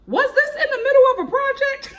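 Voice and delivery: high and loud, laughing; laughing disbelief. Real, not acted. Vlogger laughing at a church in the projects, high and loud.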